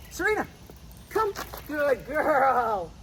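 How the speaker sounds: high happy call then praise; high cheerful praise for the dog. Real, not acted.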